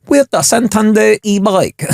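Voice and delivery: mock Thai accent, high and slow. Mock audition for a prank voiceover, in a Thai accent. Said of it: "What, Thai accent?".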